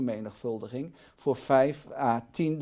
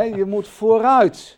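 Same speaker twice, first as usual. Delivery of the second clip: "vooruit" much louder, higher and slow; loud slow emphasis; 7 semitones higher, 12 dB louder, slower than usual. Shown as a demonstration. Teacher urges christians forward, loud slow "vooruit". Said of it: "like in a car, forward".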